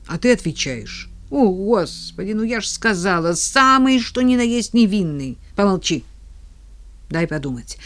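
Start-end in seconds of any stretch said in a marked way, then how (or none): none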